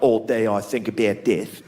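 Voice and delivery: low, slow Australian goth; slightly drawn-out mock Australian accent; gloomy goth with an Australian accent. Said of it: "That guy can't go around like".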